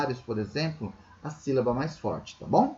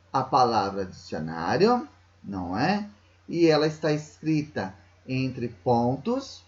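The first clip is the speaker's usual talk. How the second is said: ordinary talk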